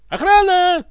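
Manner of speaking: very high, loud cry for help; yelling. A narrator's reading. Ivan calls his guards, high yell. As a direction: yell it out at full voice. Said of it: "заорал Иван".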